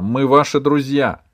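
loud reassuring call; shouting reassurance. A narrator's reading. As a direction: shout to calm the frightened man. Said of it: "закричала она".

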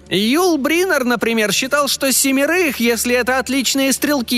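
a proud tiny fairy, pitched high. Acted. Fairy cites famous films.

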